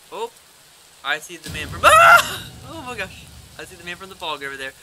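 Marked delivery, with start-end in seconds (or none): 1.8-2.5 sudden high shriek; startled scream. Real, not acted. Jump scare in game. 2.7-3.3 shaken. Real, not acted. Right after the scare.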